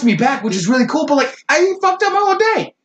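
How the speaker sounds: high-pitched, exasperated whine; mock indignation. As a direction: squeal your complaint like a put-out animal. Animal complains in a mock meeting.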